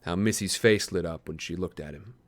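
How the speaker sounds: low, hushed storytelling voice; story narration. A narrator's reading. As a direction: read it like a dark tale, drawing it out. Reads a dark story aloud.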